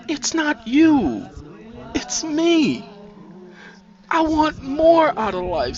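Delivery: high, slow, heartfelt explanation; emotional appeal. A narrator's reading. Nisi hugging Sandra, emotional appeal. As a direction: stretch it out, pleading to be understood.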